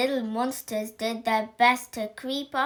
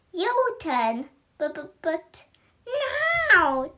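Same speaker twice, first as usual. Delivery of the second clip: demanding; 6 semitones higher, 4 dB louder, much slower than usual. Acted. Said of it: "demanded the witch".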